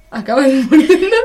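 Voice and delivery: the punchline breaks into a laugh. Real, not acted. Joking to her cohost that life ends in death, laughing.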